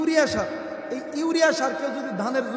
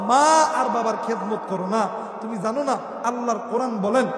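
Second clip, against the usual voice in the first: ordinary delivery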